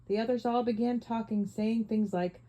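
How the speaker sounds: plain reading voice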